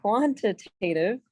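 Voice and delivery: QUANTITATIVE drawn out slowly, part by part; slow, careful. Real, not acted. Teacher retrying a tricky term for the class, slow and careful. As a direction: say QUANTITATIVE slowly so it comes out right. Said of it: "I have to say this slow".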